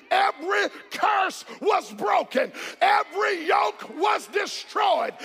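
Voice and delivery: rousing, driving sermon delivery; full-force preaching. Real, not acted. Preacher urging the church to praise God, full-force preaching. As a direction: preach it out to a congregation.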